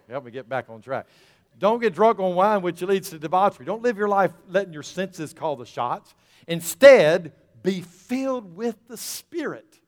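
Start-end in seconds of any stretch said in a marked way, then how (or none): 1.6-9.8 loud, raised preacher's voice; full-force preaching. Real, not acted.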